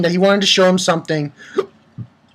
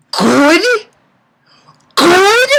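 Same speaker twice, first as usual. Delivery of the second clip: high, rising disbelief; incredulous echo; 10 semitones higher than usual. Acted. Reviewer questioning Minerva's reply, high incredulous rise. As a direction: repeat "Good" as an outraged question.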